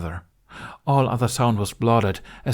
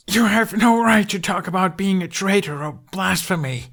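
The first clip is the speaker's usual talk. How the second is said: put-on higher, louder furious boy; angry yell; 11 semitones higher, 4 dB louder than usual. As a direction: yell it back at the traitor. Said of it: "Hiccup yelled".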